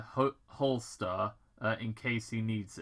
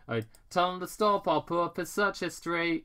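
Robotnik order; 7 semitones higher, 4 dB louder than usual. Acted. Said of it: "Doctor Robotnik ordered".

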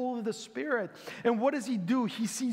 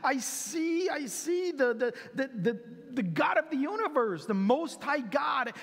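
awestruck; 6 semitones higher than usual. Retold or imitated. Stephen describing God and Jesus, high awestruck stammer. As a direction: stumble over the words in amazement. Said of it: "And he says".

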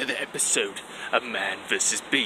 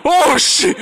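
shrieking oh shit, far higher and louder; alarm shout; 12 dB louder than usual. Acted. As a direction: scream it in blind panic. Sasquatch charges the host.

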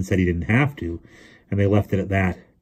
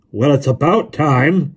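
loud, slow, hoarse exclamation; 7 semitones higher, 7 dB louder, slower than usual. A narrator's reading. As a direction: croak it out emphatically, at last. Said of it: "he said in a hoarse voice".

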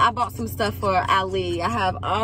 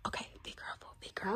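whisper; 2 semitones lower, 18 dB quieter, much faster than usual. Real, not acted. Warning the boy near the baby.

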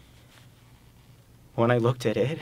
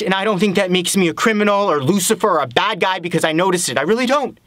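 higher, urgent, climbing to the end; insistent self-defence; 6 semitones higher, 3 dB louder than usual. Acted. Man denies to Abby being a criminal, insistent. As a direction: argue your innocence with rising force.

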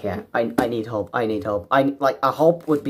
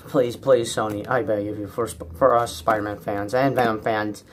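ordinary talk, nothing marked

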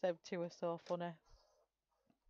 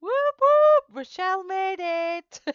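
whooping, much higher and much louder, then slower; excited cheer; 14 semitones higher, 18 dB louder, slower than usual. Real, not acted. Streamer cheers as a viewer joins the stream, loud excited whoop. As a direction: cheer her arrival at full voice.

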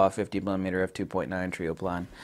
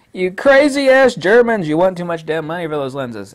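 much higher and louder, mock-shocked customer complaining about price; outraged buyer; 8 semitones higher, 10 dB louder than usual. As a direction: ham up a buyer balking at the cost. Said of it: "I'm half German, so I could say this".

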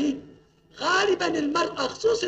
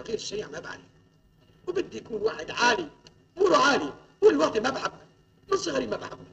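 normal talking voice

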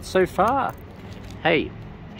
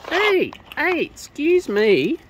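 high then slow, louder mock protest; mock indignation; 8 semitones higher, 6 dB louder, slower than usual. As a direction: pretend to be affronted by the bird.

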